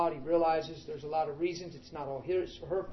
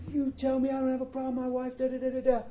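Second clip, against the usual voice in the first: higher, pleading mock outcry; mock screaming; 8 semitones higher than usual. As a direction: rattle off his complaints in a raised voice. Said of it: "he's screaming".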